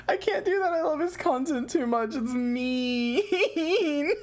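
high-pitched, helpless protest through laughter; laughing protest. Real, not acted. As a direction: squeak it while cracking up. Cracking up after own impression.

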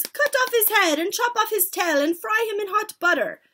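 high, loud bloodthirsty chant; cried out. A narrator's reading. Witches baying for the boy, cried out. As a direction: shriek for it like an excited mob. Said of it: "cried the witches".

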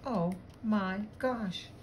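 hushed storybook astonishment, word by word; crying out in surprise. A narrator's reading. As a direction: stretch each word in stunned surprise. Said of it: "she cried".

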